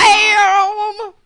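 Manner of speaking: high, loud punchline shout; dramatic exclamation. Acted. Co-host drops the big gossip on her partner with a loud exclamation. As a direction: slam it down like a reveal.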